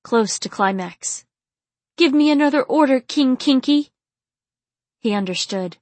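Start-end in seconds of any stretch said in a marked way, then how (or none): 2.0-3.9 high, loud and quick; urgent demand. A narrator's reading. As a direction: demand it breathlessly, high and fast. Same gasping woman demands an order.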